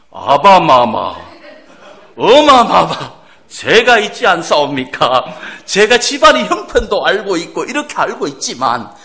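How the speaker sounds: loud, slow, hammed-up palace formality; a child addressing the king and queen in grand old court style; pompous mock courtier pleading to royal parents; mock royal court speech. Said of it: "뭐 이렇게 안 하잖아요".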